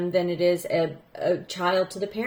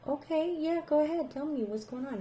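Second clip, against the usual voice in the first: higher and softer, gently urging; gentle coaxing; 8 semitones higher, 8 dB quieter than usual. Retold or imitated. Teacher to her nervous student, high soft coaxing. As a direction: coax her to speak, kindly and high.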